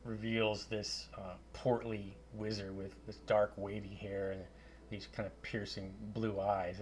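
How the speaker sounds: normal talking voice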